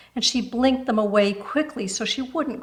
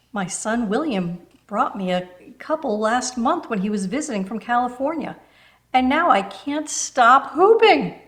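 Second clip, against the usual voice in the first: higher and louder, eager and gushing; enthusiastic older neighbour; 4 semitones higher, 4 dB louder than usual. Acted. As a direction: rave about her new hobby. Enthusiastic about hooping.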